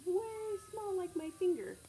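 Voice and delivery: playful mock-tiny voice for the kids. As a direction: shrink the voice way up to sound tiny. Showing how tiny a person is.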